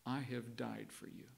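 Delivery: gentle. A narrator's reading. God answering the woman in her heart, soft and tender. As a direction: let it fall softly, like comfort. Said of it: "he said gently".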